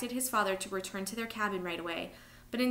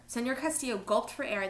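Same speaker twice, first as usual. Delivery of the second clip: ordinary delivery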